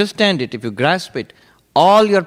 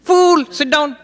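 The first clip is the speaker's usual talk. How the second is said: very high, louder scolding snap; high-pitched scolding; 5 dB louder, slower than usual. Retold or imitated. Old monk scolding an eager novice, high-pitched. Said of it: "He had a high-pitched voice".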